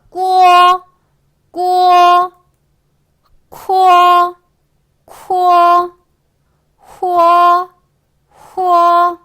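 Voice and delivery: 꾸어, 쿠어, 후어 held long on a high level tone; loud, high, drawn-out 꾸어, 쿠어, 후어; syllable drill. Shown as a demonstration. Teacher modelling pinyin finals for beginners, syllable drill. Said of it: "안 어려워요".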